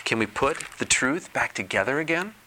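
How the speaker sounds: put-on high, eager child's question; little girl voice. A narrator's reading. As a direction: ask like a curious little girl. Little girl asking Old Turtle.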